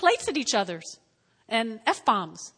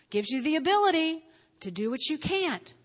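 ordinary talk, nothing marked

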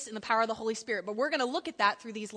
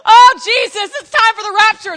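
much higher, loud hammed-up wail; mock sobbing prayer; 9 semitones higher, 18 dB louder than usual. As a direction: beg Jesus to return, fake crying. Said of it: "if you don't got a four oh one K".

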